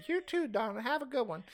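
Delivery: put-on sweet old lady, softly; a gentle granny sign-off. Mabel answers the telemarketer.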